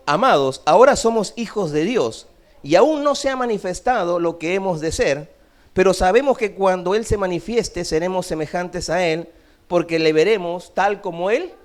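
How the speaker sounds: loud, steady scripture read to the church. Acted. Pastor proclaims the verse to the church, a loud steady reading.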